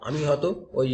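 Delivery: ordinary talk, nothing marked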